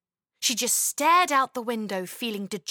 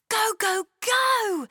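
put-on high, bursting child's urging; eager little girl; 11 semitones higher, 4 dB louder, slower than usual. Chloe urging herself up, high and eager. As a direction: Chloe itching to jump out of bed. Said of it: "Chloe thought".

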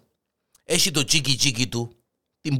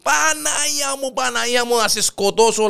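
pained outrage; 12 semitones higher than usual. Acted. Lion in agony threatening the hare, pained outrage. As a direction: wail and threaten through the pain.

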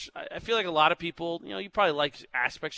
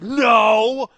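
loud insistent no, then slow on the denial; mock respondent loudly insisting they never cry; defensive denial; 5 semitones higher, 9 dB louder than usual. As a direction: protest too much, loudly. Voicing defensive poll respondents.

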